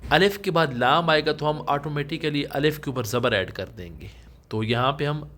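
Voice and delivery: usual speaking voice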